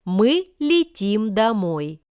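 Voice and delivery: usual speaking voice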